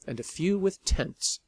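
usual speaking voice

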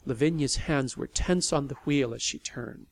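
usual speaking voice

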